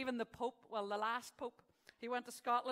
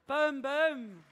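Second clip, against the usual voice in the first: loud, high, drawn-out catchphrase; booming punchline tag; 4 semitones higher, 13 dB louder than usual. Pretend. Comic host to audience after a joke, booming. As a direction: belt it out to cap a corny joke.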